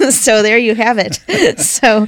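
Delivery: laughing through the words. Real, not acted. Telling the interviewer the story's punchline, laughing. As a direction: chuckle it out, amused. Laughing at the shop owner's joke.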